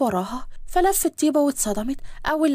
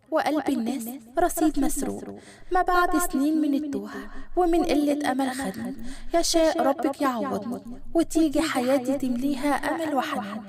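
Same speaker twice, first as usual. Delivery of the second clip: love poem recital; 4 dB quieter than usual. Acted. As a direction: recite it unhurriedly, lingering on each phrase.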